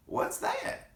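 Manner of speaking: high whispered squeak; whispered granny question. Acted. Granny voiced in story.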